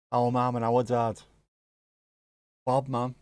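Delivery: normal talking voice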